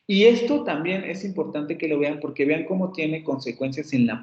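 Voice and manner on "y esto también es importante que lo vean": ordinary talk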